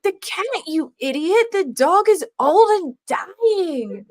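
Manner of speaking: high, slowed, scornful imitation; mock scornful. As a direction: sneer it at the idiot who asked. Voicing girlfriend's scornful answer.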